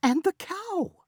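very high, slow exclamation; high worried outburst; alarmed cry. Acted. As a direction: cry out in dismay. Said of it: "Madrana cried".

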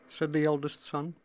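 usual speaking voice

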